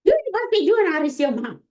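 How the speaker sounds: high, fast mock mama scolding; playful impression of mama telling her off. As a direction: imitate an exasperated mother fussing at her kid. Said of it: "She's like".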